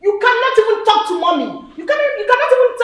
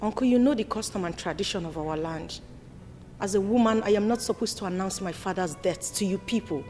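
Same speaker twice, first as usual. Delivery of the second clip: much lower, soft complaint; low earnest plea; 10 semitones lower, 6 dB quieter than usual. Acted. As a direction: confide the betrayal to uncle. Daughter pleading quietly to uncle.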